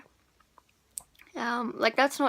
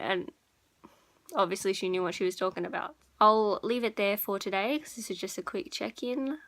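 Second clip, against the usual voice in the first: normal talking voice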